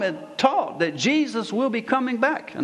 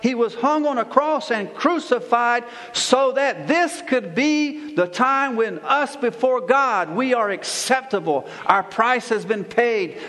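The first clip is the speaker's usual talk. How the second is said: forceful preaching; 4 semitones higher than usual. Real, not acted. Pastor proclaiming Christ as king to his congregation, forceful preaching.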